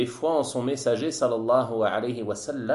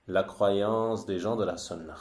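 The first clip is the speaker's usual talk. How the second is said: ordinary delivery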